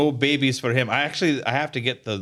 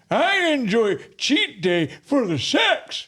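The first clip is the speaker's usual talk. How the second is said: put-on slow, higher creaky old man; 10 semitones higher, slower than usual. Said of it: "my old man voice".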